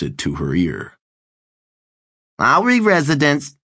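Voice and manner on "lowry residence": high, nasal and bored, switchboard operator. Acted. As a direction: drone it like a bored answering-service operator. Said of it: "says the bored nasal voice".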